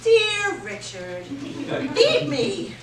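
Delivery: high, slow taunting sing-song; mocking voice. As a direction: taunt him in a silly voice. Friend mocks in put-on voice.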